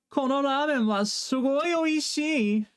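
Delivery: high, slow, delighted exclamation; excited buddy. Pretend. Buddy raves about the ramen, voice pitched high and slow. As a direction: gush about the ramen like a happy friend. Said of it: "when your buddy says".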